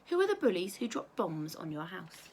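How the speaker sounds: ordinary delivery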